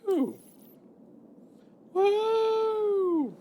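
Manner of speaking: whooping cheer, very high; excited whoops; high excited woo. Real, not acted. Excited cheering while flying off.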